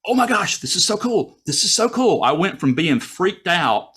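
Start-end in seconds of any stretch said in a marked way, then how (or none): 0.1-2.2 much higher, breathless wonder; high excited gushing; thrilled. Retold or imitated. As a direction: gush like a delighted kid. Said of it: "it was like".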